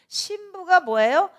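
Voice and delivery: high, loud and slow, pressing the question; drawn out and called to the congregation; emphatic question. Acted. Preacher pressing the congregation with a slow loud question.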